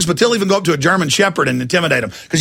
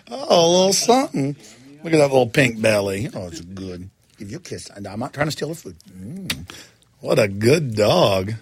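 cooing at a dog; 4 semitones lower, 3 dB quieter than usual. Real, not acted. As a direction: coo over the little dog.

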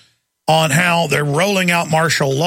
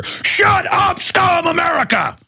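much higher, shouted abuse; screaming mock cop; 13 semitones higher, slower than usual. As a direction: scream it like a sneering thug cop. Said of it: "cops scene, dog attack".

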